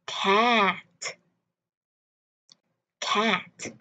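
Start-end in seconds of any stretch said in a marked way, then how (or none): 0.1-0.9 word stretched, ending sounded; slow demonstration. Shown as a demonstration. Teacher models the word for learners, slow with audible ending. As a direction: draw out the vowel, then release the t. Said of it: "hai lần chậm hơn".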